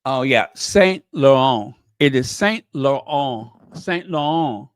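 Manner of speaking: Saint Laurent said the French way with a nasal ending; careful French Saint Laurent; French pronunciation. Shown as a demonstration. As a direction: say Saint Laurent like a French speaker, dropping the final t. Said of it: "It's very French".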